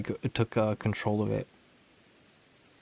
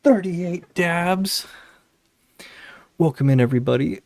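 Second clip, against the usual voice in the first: excited; 7 semitones higher, 6 dB louder than usual. Real, not acted. Finishing dab count.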